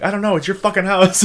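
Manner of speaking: high, loud mock indignation; worked-up comic protest; exasperated retort. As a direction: throw the job back at your friend. Reenacts exasperated retort to friend.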